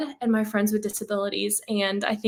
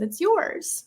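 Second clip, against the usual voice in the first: lightly voiced distant woman, lifted high; a high, lilting call as if from afar; 8 semitones higher than usual. Woman answering the dreamer across the garden, from afar. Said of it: "woman's voice from afar".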